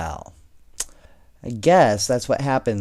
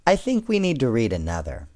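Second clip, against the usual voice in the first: ordinary delivery, nothing marked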